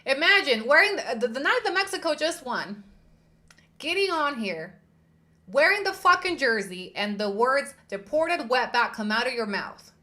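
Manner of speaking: loud, high indignant rant; outraged. Real, not acted. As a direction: rant in disbelief, raising your voice. Outraged rant about slur.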